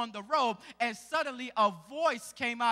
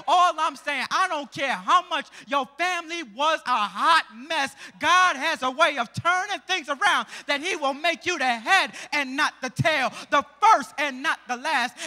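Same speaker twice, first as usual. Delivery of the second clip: rising preacher's call, louder; preaching; 3 semitones higher, 8 dB louder than usual. Acted. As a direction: lift the room with a promise. Preaching burst mid-story.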